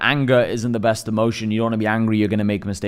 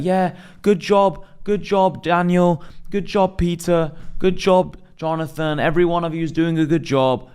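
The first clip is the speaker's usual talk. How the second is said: much higher, slow, sugary pat-on-the-head praise; mocking praise; 6 semitones higher, slower than usual. Boss too happy to push his staff, mocking sing-song praise. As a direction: coo at each teammate like a pushover boss.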